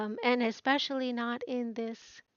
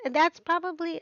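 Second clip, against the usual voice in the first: normal talking voice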